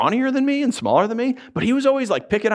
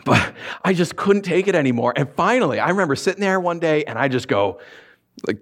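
ordinary delivery

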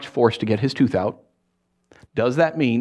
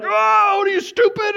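much higher, shouted scornful jab; mock angry name-calling; 4 dB louder than usual. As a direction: sneer and yell at the foolish one. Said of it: "it's so easy to be like".

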